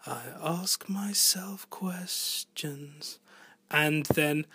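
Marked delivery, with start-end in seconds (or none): 0.1-3.2 soft, slow, breathy-whispered line; hushed soft singing voice; very soft vocal. Shown as a demonstration. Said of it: "between very soft vocal".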